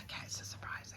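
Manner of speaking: voiceless hushed repeat; whispering. Real, not acted. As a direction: whisper it back to keep the secret.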